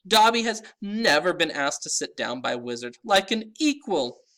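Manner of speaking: high eager elf voice; squeaky, reverent house elf. A narrator's reading. Elf character voice.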